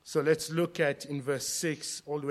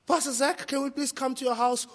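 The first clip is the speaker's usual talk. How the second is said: higher-pitched imitation of nephews begging; pleading little children; 9 semitones higher than usual. Imitated nephews begging uncle for a visit, high pleading. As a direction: put on a high child's plea. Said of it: "it will always go like this".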